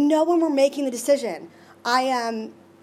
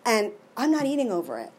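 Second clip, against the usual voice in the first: usual speaking voice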